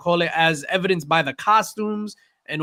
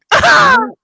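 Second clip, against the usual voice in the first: loud squealing laugh; high shriek laugh; 9 dB louder than usual. Real, not acted. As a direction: burst out in a shrill cackle. Big laugh.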